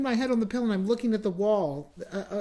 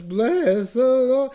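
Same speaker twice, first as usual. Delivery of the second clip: imitating a strained, broken high note, loud and slow; mock the note that wouldn't come out; cracked failed note; 6 semitones higher, 9 dB louder, much slower than usual. Singer reenacting his voice breaking at church, cracked and loud. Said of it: "but it was not a note".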